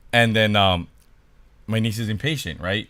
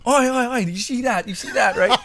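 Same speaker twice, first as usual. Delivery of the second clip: high, excited egging-on voice; instigating; 10 semitones higher than usual. Retold or imitated. Daughter eggs on the niece, instigating. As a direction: stir her up, pitched high, laughter creeping in. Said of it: "my daughter instigating".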